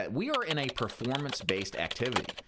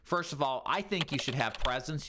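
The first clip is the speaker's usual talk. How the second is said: normal talking voice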